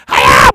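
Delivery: sharp loud karate cry; loud karate kiai; karate yell. Acted. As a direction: snap out a karate shout. Karate shout.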